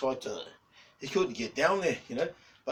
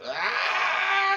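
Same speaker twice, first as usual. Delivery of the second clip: long high-pitched "aah" scream; loud drawn-out mock wail; mimicked scream; 12 dB louder than usual. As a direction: let out an imitated shriek, way up high. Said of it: "And they're like".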